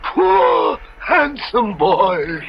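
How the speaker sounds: mock pity. Stranger mocks the ruined youth.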